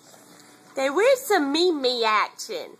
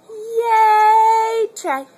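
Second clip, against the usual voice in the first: excited cheer; 8 semitones higher, 8 dB louder than usual. Real, not acted. Mother cheering her baby for feeding himself, high and bright. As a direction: cheer him on.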